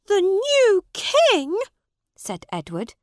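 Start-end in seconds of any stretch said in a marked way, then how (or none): 0.1-1.6 1 stunned disbelief. A narrator's reading. Prince hears of new king.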